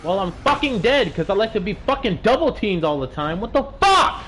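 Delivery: loud, high shouting rant; angry rant. Real, not acted. Complaining to stream about being double teamed, loud angry rant. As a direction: spit it out, fed up and yelling.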